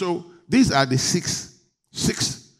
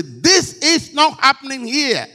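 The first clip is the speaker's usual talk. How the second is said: emphatic refusal; 9 semitones higher, 6 dB louder than usual. Shown as a demonstration. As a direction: lean hard on each word. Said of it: "You got to be emphatic and say".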